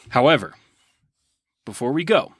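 ordinary delivery

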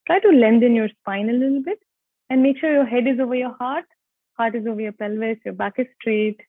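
calm guided meditation voice. Acted. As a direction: guide them gently into the breath. Leads breath practice.

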